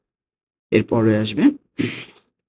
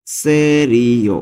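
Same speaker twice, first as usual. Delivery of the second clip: slow repeat; much slower than usual. Shown as a demonstration. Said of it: "teacher repeats slowly".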